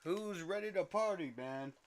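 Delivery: high, rowdy party call; rowdy party animal. Real, not acted. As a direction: get the crowd going. Hyping the stream crowd.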